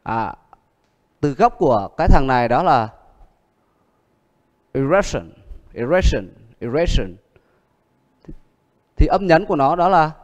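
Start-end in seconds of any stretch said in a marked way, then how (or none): 4.7-7.2 erection with the middle syllable stressed; stress on second syllable. Shown as a demonstration. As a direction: lean on the second syllable of erection, then fall. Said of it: "âm hai".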